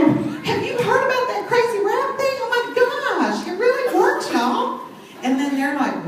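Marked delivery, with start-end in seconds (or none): 0.0-4.8 much higher, bubbly and thrilled; excited. Shown as a demonstration. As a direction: sell it like the news can't wait. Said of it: "No, you got to go".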